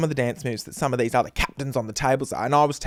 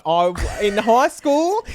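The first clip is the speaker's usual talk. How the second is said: ordinary delivery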